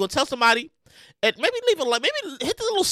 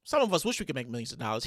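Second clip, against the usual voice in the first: low, fast mutter; quiet fast aside; 13 semitones lower, 11 dB quieter, faster than usual. Real, not acted. Host muttering his envy aside. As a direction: toss it off under breath.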